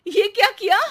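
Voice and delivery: sharp, loud and high rebuke; angry scolding. Acted. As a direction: scold the maid for the spilled wheat. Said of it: "Anuradha वहां उसे गुस्से से देख रही है". (गुस्से में ऊँची आवाज़ में डाँटना)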